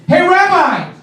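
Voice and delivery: imitating a man shouting from the subway; retold yell. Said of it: "he just points and he goes".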